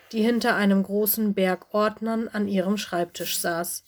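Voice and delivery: plain reading voice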